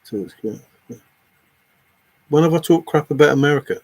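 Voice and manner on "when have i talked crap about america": loud, quick indignant challenge. Real, not acted. Streamer challenging a viewer who accused them of insulting America, indignant. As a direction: throw the accusation back loudly and fast.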